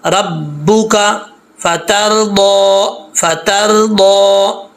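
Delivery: slow melodic tajweed recitation, held out; Quran recitation. Shown as a demonstration. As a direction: recite it slowly, like in prayer. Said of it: "अब इसको sound में पढ़ना है". (धीमी, सुरीली तिलावत)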